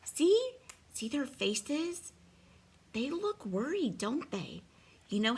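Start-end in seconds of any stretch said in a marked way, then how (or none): none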